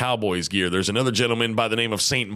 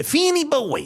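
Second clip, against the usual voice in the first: put-on much higher, sing-song "Finny boy"; mom's pet name. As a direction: coo it like a doting grandma. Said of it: "and my mom, she's".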